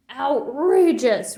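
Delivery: slow, loud drawn-out roar; Papa roaring with anger. A narrator's reading. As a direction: bellow it in outrage. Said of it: "roared Papa".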